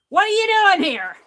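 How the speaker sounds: fast, loud, high demand from a rude boss; mock-hostile boss, loud and quick; boss's gruff challenge. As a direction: bark it at the unwelcome visitor. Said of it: "That's how he said it in my dream".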